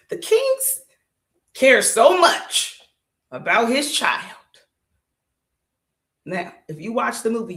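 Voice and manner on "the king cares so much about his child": high, slow mock sincerity; sarcastic. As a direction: drag it out, dripping sarcasm. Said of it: "you know I'm being sarcastic".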